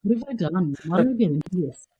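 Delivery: hammed-up teacher lament; mock despair. Teacher lying on a desk, mock despairing to the class. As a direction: wail at the class, half joking. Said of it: "And I go".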